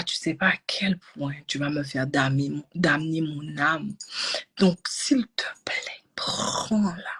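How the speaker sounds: prière lente et soufflée; supplique chuchotée. Retold or imitated. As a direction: implorer tout bas, lentement. Supplique au champ d'énergie. (supplique lente et chuchotée au médecin)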